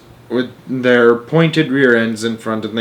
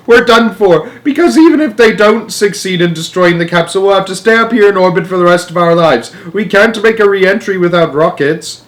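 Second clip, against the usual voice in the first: high, loud, urgent tirade; despairing shout; 8 semitones higher, 5 dB louder than usual. A narrator's reading. Astronaut stranded in orbit, shouting. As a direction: shout that all is lost. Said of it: "shouted Shanks".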